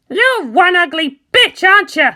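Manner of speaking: young pilot mocking the creature; taunting. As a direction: jeer at the ugly monster. Kara taunts the monster.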